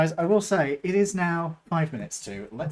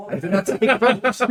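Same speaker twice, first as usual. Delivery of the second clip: mock French voice; 5 semitones higher, 9 dB louder than usual. GM breaks character laughing.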